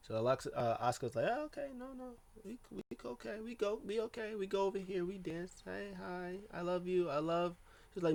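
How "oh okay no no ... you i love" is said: high, soft squeaky-eager imitation; imitated eager Asuka. Asuka placating Alexa, imitated in a high eager voice. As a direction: pitch way up, placating and quiet.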